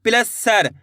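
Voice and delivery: normal talking voice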